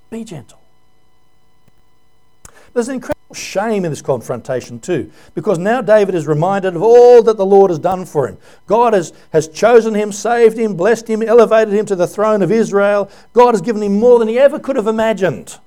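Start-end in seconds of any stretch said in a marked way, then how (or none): 0.1-0.6 Be gentle dropped to a soft hush; hushed, intimate echo of the plea; softly repeated. Shown as a demonstration. Preacher repeats the exhortation. 5.3-15.6 louder, rising preacher's cadence; full-force preaching. Acted.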